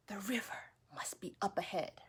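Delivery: unvoiced whisper to herself; whispered thought. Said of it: "she thought".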